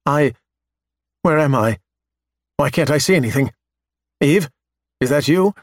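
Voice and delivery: lightly frightened, confused waking voice; a touch of fear, disoriented. Acted. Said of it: "The voice sounds frightened".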